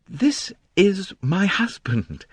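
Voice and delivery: slow, with a faint tremor; a touch of proud shyness in the voice. A narrator's reading. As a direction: let the voice tremble slightly. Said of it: "A faint tremor in the voice".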